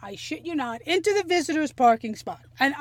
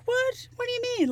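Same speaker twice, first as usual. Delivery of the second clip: much higher, startled what, what do you mean; shocked disbelief; 12 semitones higher, faster than usual. Retold or imitated. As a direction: squeak it out, caught off guard. Girlfriend suggests the hospital.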